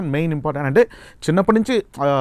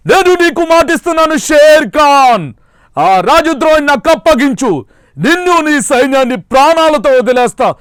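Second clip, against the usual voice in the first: Ram Charan Magadheera roar; 10 semitones higher, 8 dB louder than usual. Retold or imitated. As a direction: hurl the dare at the enemy commander. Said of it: "stage మీద Magadheera dialogue అండి".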